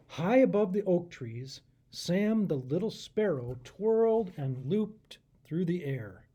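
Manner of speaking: put-on lively read-aloud for children; story narration. A narrator's reading. Pastor reading the story to kids. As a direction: read it like a bedtime picture book.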